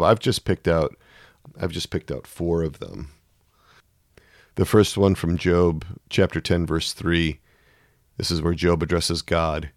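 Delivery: usual speaking voice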